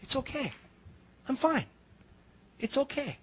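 very soft, hushed reassurance; calm a frightened girl softly; a man softly soothing the scared teen driver. Retold or imitated. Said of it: "just kept telling her".

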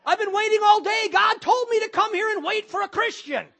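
acted-out outburst, shouting high and indignant; angry man. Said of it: "the angry looking man with the turban".

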